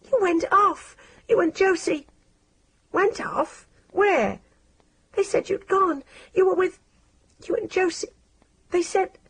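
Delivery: put-on high, broken, accusing teenage girl; halting and hurt; girl confronting her friend; upset girl Maggie. A narrator's reading. Maggie voiced in story.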